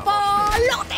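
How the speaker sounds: a big victorious shout; triumphant yell. Real, not acted. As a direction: yell it out after the kill. Triumphant kill catchphrase. (grito triunfal)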